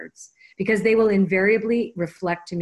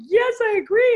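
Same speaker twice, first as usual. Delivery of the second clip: high, loud gushing card; a hammed-up agreeable card; mock card voice; 6 dB louder than usual. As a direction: give the card a bubbly friend's yes. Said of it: "And the card's going".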